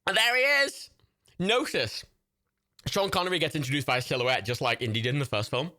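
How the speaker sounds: usual speaking voice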